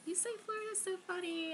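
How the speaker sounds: high teasing quote; mock friend voice. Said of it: "everyone is like".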